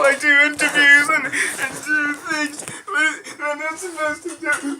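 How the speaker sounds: high, loud hammed-up sobbing; mock crying. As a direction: wail like a wronged child.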